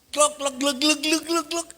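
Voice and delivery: high clucking hen noise; playful hen clucks. Retold or imitated. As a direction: cluck it out like a chicken. Imitating clucking hen sound.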